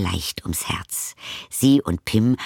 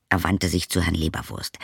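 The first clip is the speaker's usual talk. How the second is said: usual speaking voice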